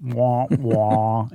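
lightly voiced robot wah wah; a hint of a flat robot voice. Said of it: "in a robot voice".

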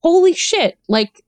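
a high, excited gasp of discovery, slowing on the last words; hooked at first taste; retold awe at something unexpectedly good; amazed delight. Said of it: "And I d-, dove in and I was like".

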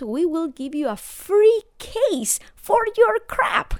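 hammed-up TV pitchman, high and loud; infomercial. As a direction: sell it like a late-night ad. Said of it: "All these infomercials".

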